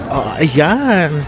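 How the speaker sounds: ordinary delivery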